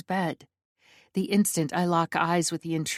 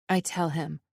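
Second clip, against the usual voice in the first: plain reading voice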